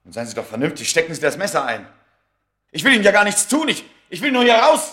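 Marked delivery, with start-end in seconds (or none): 2.7-4.9 much higher, louder desperate plea; panicked pleading. Acted. Smith facing the knife, panicked high loud plea. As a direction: beg for your life.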